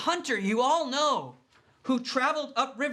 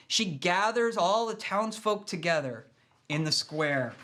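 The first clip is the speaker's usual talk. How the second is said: ordinary talk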